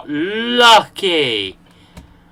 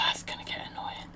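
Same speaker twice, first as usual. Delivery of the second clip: whispering; 17 dB quieter, faster than usual. Real, not acted. Annoyed aside while cave sound plays.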